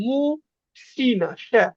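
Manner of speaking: মুশিনা very slowly, broken as mu-shina, with a hushing sh; demonstrated pronunciation. Shown as a demonstration. Teacher pointing out the sh sound, slowly sounding out মুশিনা. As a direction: stretch মুশিনা out, then isolate শ্যা. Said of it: "কিন্তু শ্যা উচ্চারণ হবে".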